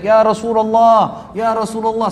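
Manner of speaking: loud, drawn-out call across a crowd; calling from afar. Retold or imitated. Abu Bakar calls to the Prophet from afar, loud. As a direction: shout it slowly so everyone turns. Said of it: "dari jauh teriak".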